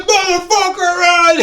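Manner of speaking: high-pitched imitation of an angry coworker yelling; retold shouting at a passerby; mock outburst. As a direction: holler it high, mimicking his rant. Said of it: "he would just start".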